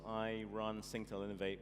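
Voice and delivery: usual speaking voice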